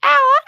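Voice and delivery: loud, piercing "ow" signal; high-pitched screech. Shown as a demonstration. Calling separated kids back, high-pitched screech. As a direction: screech high and sharp to summon people. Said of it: "I do it really high pitched".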